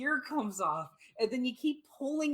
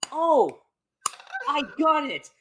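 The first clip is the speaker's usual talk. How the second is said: loud, higher burst of discovery; sudden idea; 4 semitones higher, 8 dB louder than usual. Acted. Parent spotting the favorite toy, sudden excited realization. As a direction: act out a eureka moment.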